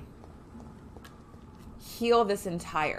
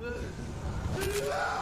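startled oh; 3 dB quieter than usual. Real, not acted. Shocked in-game.

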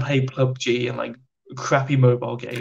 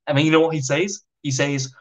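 normal talking voice

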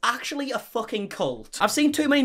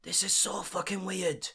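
creeped-out murmur; 2 semitones lower, 7 dB quieter, slower than usual. Real, not acted. Creeped out by the speech.

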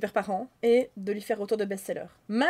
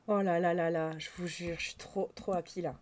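hushed joy; 6 semitones lower, 6 dB quieter than usual. Real, not acted. Confie sa joie à voix basse.

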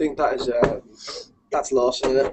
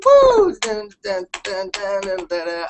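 imitating stage hype; slower than usual. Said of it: "and I go".

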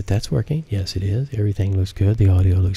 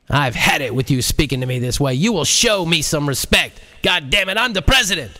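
higher, rising into an outraged near-yell; angry president; 6 semitones higher than usual. A narrator's reading. The president loses his temper at JL, near-yelling. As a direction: build from anger to a high outraged cry. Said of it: "The president was almost yelling".

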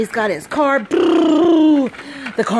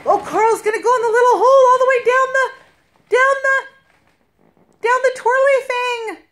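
fast, higher excited commentary; 7 semitones higher, faster than usual. Real, not acted. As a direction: rush it like breathless excitement. Excited play narration.

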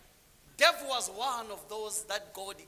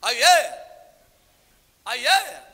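calling out; 12 dB louder than usual. Real, not acted. Preacher calls out to congregation.

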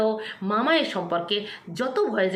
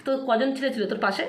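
normal talking voice

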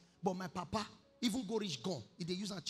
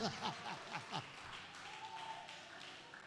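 soft unvoiced laugh; breathy laugh; 9 dB quieter than usual. Real, not acted. Preacher laughing at his own joke, softly. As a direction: let a quiet laugh out.

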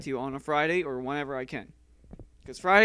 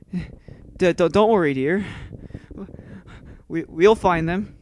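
louder, slower stuttered reassurance; mock scared stutter; 8 dB louder, slower than usual. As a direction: stammer bravely but scared. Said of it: "Now you're scared".

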